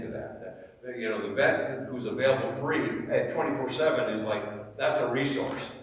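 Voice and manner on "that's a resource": usual speaking voice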